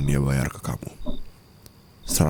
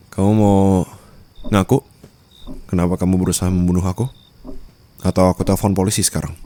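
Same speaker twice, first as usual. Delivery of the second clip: loud, fast interrogation; louder, rushed accusation; angry demand; 3 semitones higher, 5 dB louder, faster than usual. Acted. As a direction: fire the questions at them. Demanding a confession.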